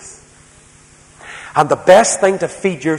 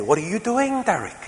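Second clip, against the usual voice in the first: high, light imitation of a three-year-old; lightly voiced small child asking; little boy; 8 semitones higher than usual. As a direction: mimic a curious toddler. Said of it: "he say".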